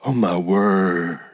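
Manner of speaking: low, long-drawn mock amazement; mock awe. Preacher mocks church as a big show, in drawn-out awe. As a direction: stretch it out low, like an overawed churchgoer. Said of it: "this big show-up gig like".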